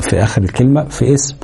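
ordinary talk, nothing marked

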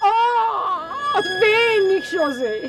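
high wailing oh, voice cracking; anguished cry. Acted. Lady cries out to José in despair.

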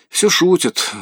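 bitter sneer. A narrator's reading. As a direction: spit it out with a sour smirk. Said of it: "зло усмехнулся Лучников".

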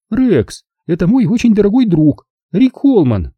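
high-pitched woman character; introducing hostess. A narrator's reading. Zelda presents her dear friend to Rex, high hostess voice.

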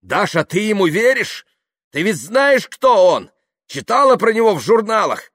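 loud, high, incredulous tirade; ranting. Acted. Yuklin urging Dasha not to believe the party-goer, ranting. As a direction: shout with mounting scorn.

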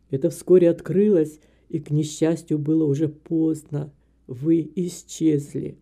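put-on low older man's voice explaining himself; remorseful old man. A narrator's reading. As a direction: justify the mistake, measured and low. Narrator voices Graham explaining. (старик оправдывается низким голосом)

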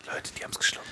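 conspiratorial whisper. Acted. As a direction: whisper it to your friends. Secretly gloating after the trick.

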